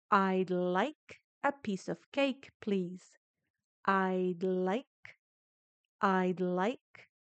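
"I'd like a piece of cake, please" slow and linked, "I'd" gliding into "like"; slow repeat-after-me. Shown as a demonstration. Teacher leads the class through ordering cake, slow and even. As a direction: say it slowly, linking the words without thinking of letters. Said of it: "repitan conmigo".

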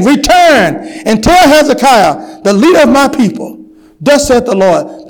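scripture called out with sermon force; impassioned scripture preaching. Real, not acted. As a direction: proclaim the verse to the congregation.

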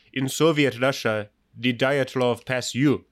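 slow, heavy Russian accent. Shown as a demonstration. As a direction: deliver it like a Russian comedian. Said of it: "the only accent I can do very well is my Russian accent".

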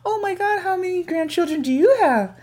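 very high, gushing nosy questions; chatty grandma voice. Retold or imitated. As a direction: squeal it like an eager old lady. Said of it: "She asks every question".

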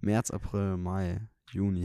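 ordinary talk, nothing marked

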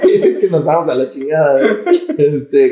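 high laughter through words; laughing. Real, not acted. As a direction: laugh while talking.

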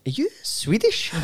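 high, slow mock questioning; imitated puzzled passenger. Couple on the plane turning round, mock puzzled. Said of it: "the couple in front of us turned round".

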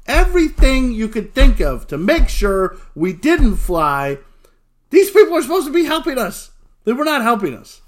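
loud, high-pitched storyteller's outrage; exasperated rant. Retold or imitated. Exasperated at unhelpful airport staff.

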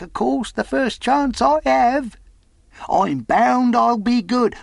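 high-pitched eccentric sailor, insistent; rambling pious castaway. Acted. Marooned sailor tells Jim his life story, earnest and high.

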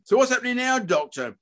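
high-pitched, indignant questioning; agitated demand. A narrator's reading. Father demanding answers from surgeon, agitated. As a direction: press the doctor angrily.

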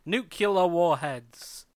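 "Nuclear" said slowly as NOO-kyuh-luh, then "warheads"; "Nuclear" as nu-cu-lar, drawn out; nucular mispronunciation. Shown as a demonstration. A mocking example for his co-host, saying "Nuclear" as nucular. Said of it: "People genuinely say nuclear".

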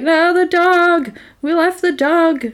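high mock wail of panic; distraught. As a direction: imitate her crying out in dismay, stretched high. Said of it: "she's distraught because".

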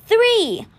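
high, loud call of the count; shouted count. A narrator's reading. Statue teacher signalling the students to fire, shouting.

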